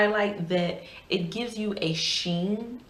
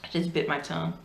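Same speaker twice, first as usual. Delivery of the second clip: ordinary talk, nothing marked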